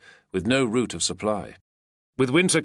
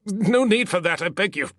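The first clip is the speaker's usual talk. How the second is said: begging; 11 semitones higher, 4 dB louder than usual. A narrator's reading. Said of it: "clasping his throat".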